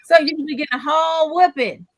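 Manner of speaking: mock parental scolding. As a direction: threaten a crying child like an old-school parent. Acts out old-style parent scolding crying child.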